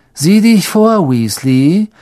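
high, loud taunt; jeering. Acted. Malfoy jeers at Ron, high and loud. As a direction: jeer a threat at him. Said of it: "höhnte Malfoy".

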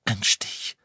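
indistinct, toneless mumble; mumbled. A narrator's reading. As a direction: mumble it as if the face hurts. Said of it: "murmelte Harry".

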